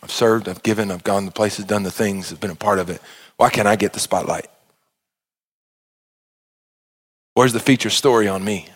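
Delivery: low, soft, mocking whine; mock church complaint. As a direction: murmur the complaint as a pious grumbler. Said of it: "church people would say it like this".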